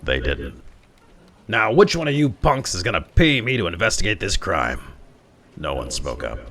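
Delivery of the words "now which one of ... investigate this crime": higher, louder demand; demanding cop. Acted. Detective demands payment from the workers, louder. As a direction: push the crowd like a tough cop.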